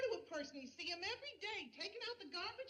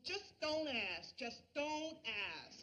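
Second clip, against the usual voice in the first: dazed refusal; much slower than usual. Acted. Dazed friend putting off questions about a secret, very slow. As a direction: draw out each word as if in a trance.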